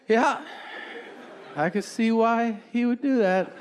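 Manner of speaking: high, falsely cheerful giving in with a forced laugh; mock-sweet surrender ending in a fake laugh; fake agreeable. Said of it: "I took a deep breath and I just went".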